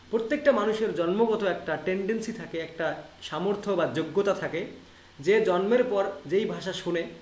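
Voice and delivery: ordinary delivery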